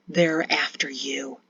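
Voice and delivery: low, slow, distant menace; eerie sleepwalker voice. A narrator's reading. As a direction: speak low and slow, half asleep. Sleepwalking father with sword.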